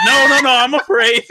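high, loud panicked protest; retold terror. Teller refuses the woman's coaxing, retold terror. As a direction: shout it in mock fright. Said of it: "'cause I was terrified".

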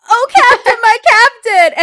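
loud, high panicked cry; mock panic. Imitating Todd at the film's end, panicked. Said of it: "kind of in a panic say it".

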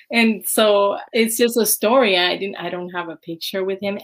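usual speaking voice

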